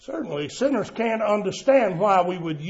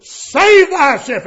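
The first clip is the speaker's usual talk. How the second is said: higher, louder jeering challenge; mocking soldiers; 6 semitones higher, 6 dB louder than usual. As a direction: dare him to prove he is king. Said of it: "mocking his relationship".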